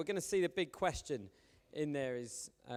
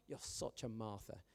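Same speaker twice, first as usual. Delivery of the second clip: You're such a Martha tossed off softer; soft aside; 5 semitones lower, 10 dB quieter than usual. Retold or imitated. A churchgoer muttering a label at a friend, softer. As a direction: throw the line away quietly. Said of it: "anyone come up to you in church and go".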